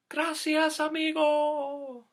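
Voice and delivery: high, drawn-out grateful call; the creek thanking its friend; high slow water voice. Acted.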